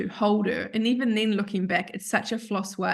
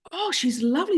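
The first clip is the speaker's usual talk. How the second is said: gasp; 6 semitones higher than usual. Retold or imitated. Admirers in Australia.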